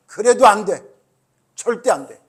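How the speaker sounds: loud, stern mother's no, acted out; imitated mother putting her foot down; firm refusal. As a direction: lay down the law like a mom.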